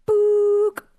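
imitated machine beep; high, loud beep sound; mock voicemail tone.

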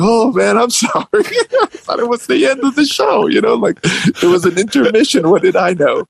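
high-pitched, pleading excuse; mock defensive apology. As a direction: replay protesting innocence to the manager. Defends himself to manager, retold.

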